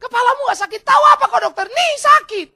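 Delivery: high, loud angry protests; hammed-up patient shouting down the doctor; indignant pained yelling. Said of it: "dia teriak-teriak sakit".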